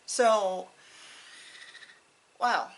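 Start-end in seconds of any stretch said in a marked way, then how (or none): none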